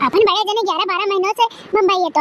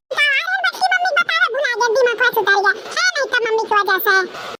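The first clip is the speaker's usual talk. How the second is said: put-on child voice; 5 semitones higher than usual. Son teases mother.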